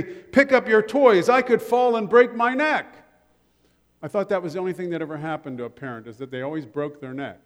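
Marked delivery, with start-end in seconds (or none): 0.0-2.9 higher, louder mock complaint from a dad who stepped on a toy; dad scolding. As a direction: scold the kid as a sore dad. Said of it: "he would say".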